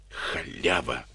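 hushed, hissed insult, well below full voice; soft scolding hiss through the teeth; angry hiss. A narrator's reading. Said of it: "прошипел он".

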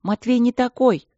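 put-on high, girlish protest; indignant. A narrator's reading. Princess defending her suitor, indignant. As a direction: object indignantly on his behalf. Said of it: "возмутилась Алина".

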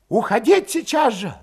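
high-pitched, urgent rallying; heated agitation. A narrator's reading. Valet urging everyone to flee today, fired up. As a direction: whip them up, high and heated. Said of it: "возбуждающе горячился Валет".

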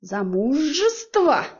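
медленно, выше и громче, с яростью; выцедить слово как фурия; шипение фурии. A narrator's reading. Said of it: "зашипела я, как фурия". (злобное растянутое «Замужество!»)

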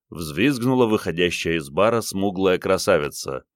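plain reading voice

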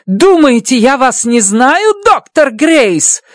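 shouting. Acted. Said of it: "заорала она".

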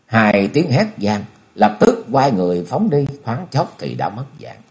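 plain reading voice

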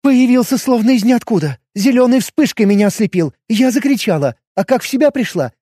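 high, breathless agitated retelling; rattled girl pouring it out. A narrator's reading. Shaken daughter recounts attacker.

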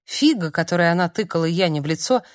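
plain reading voice

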